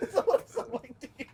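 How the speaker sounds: put-on old man voice. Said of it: "That'd be your old man voice".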